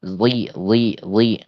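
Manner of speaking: "li" with back of tongue dropping sharply; thick li demo. Shown as a demonstration. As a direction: drop the tongue fast on "li". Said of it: "lidah belakang langsung tiba-tiba ke bawah".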